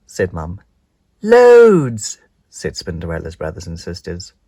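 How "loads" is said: loud shout. Acted. Siblings loud.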